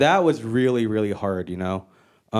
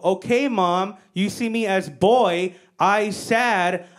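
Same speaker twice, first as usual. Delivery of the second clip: slow, higher broken English to mom; simplified English; 4 semitones higher, much slower than usual. Retold or imitated. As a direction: spell out a mock threat word by word. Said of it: "And I go".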